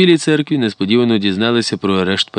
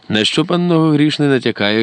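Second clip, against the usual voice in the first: higher-pitched narrated threat; menacing question; 7 semitones higher than usual. A narrator's reading. Porodovsky questioning the informer, mildly menacing. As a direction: demand it with mild menace. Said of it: "грізно спитав Породовський".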